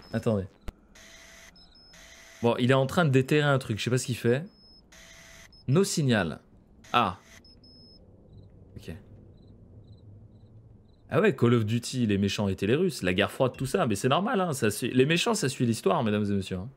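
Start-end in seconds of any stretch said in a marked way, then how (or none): none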